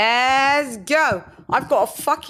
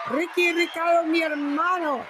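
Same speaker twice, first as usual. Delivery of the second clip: hype shout-out; 6 semitones higher than usual. Real, not acted. Hyped roll call shout.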